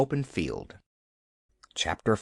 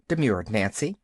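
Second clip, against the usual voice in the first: ordinary delivery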